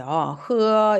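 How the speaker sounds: 허 drawn out, gliding from 으 to 어; initial h sound. Shown as a demonstration. Teacher recalls how initial h was read, long 허. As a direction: stretch 허 as a Chinese h. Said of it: "이렇게 읽었지만".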